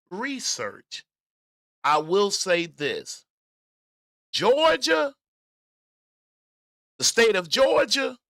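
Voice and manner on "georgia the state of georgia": high, loud stress on "Georgia"; emphatic announcement. Real, not acted. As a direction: proclaim "Georgia" loudly, pitched way up. Dramatic build-up to a claim.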